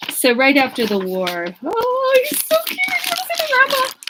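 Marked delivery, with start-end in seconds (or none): 1.6-4.0 much higher, fast, sing-song cooing. Real, not acted. Cooing over grandpa's baby photo.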